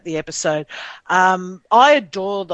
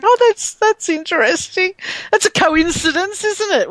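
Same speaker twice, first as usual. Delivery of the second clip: high, louder mock-innocent wondering; clueless musing; 10 semitones higher, 4 dB louder than usual. Mocking her own oblivious past self, high naive wondering. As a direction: play your past self missing the obvious. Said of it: "So I was just like".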